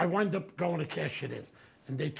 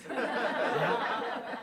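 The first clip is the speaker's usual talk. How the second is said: normal talking voice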